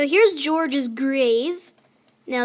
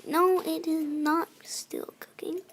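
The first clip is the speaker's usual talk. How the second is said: whispered self-answer, slow; 7 dB quieter than usual. Real, not acted. Answering her own question about the furnace, whispering. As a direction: breathe it to yourself in a whisper.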